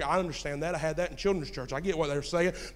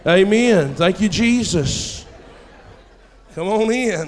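loud preacher's praise; 3 semitones higher, 11 dB louder, slower than usual. Acted. Preaching punchline.

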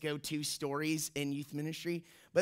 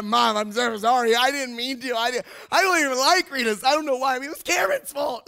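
high, loud, frantic apology; freaking out; 6 semitones higher, 10 dB louder than usual. Retold or imitated. Boy caught by his mom, imitated frantic pleading. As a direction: blurt excuses in a mock panic. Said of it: "Like, I was freaking out".

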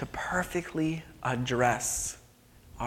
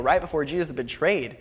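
ordinary talk

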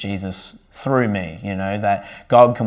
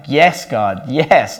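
rising, louder cries of praise; joyful praise; 4 semitones higher, 6 dB louder, much slower than usual. Retold or imitated. Driving alone, praising God aloud, joyful rising cries. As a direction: let the praise well up and lift.

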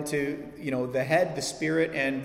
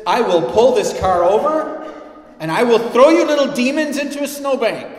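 put-on high, loud furious yell at the kids; angry parent shout; 9 semitones higher, 12 dB louder than usual. Preacher playing a parent yelling at the kids in the car. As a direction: bellow it like a fed-up parent.

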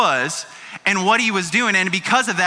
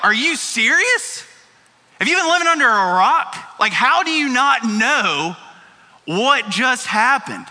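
mad disbelief; 3 semitones higher than usual. Retold or imitated. Two disciples answer a clueless stranger, mad and incredulous. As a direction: act exasperated, stretching the words in disbelief. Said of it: "And they're mad".

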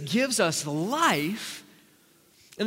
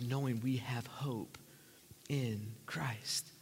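hushed; 7 semitones lower, 11 dB quieter, much slower than usual. Real, not acted. Pastor hushing to his congregation about hope, near whisper.

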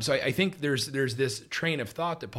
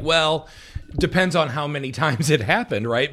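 higher, louder sarcastic comeback with a laugh; mock sarcastic; 4 semitones higher, 5 dB louder than usual. Joking about what he would have answered on salvation, mock sarcastic. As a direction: play up the sarcasm, laughing through it. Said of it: "I just wanted to be sarcastic".

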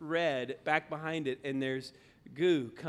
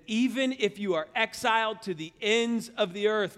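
raised, emphatic preacher's reading; scripture reading; 5 semitones higher, 5 dB louder than usual. Acted. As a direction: read the verse out with rising force. First reading of verse.

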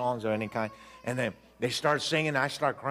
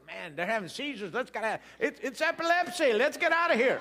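panicky, climbing much higher toward the end; freaked out; 9 semitones higher than usual. Retold or imitated. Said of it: "I said".

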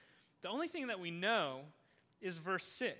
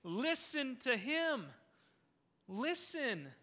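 the Father commanding; 2 semitones higher, much slower than usual. Retold or imitated. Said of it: "here's the Father saying".

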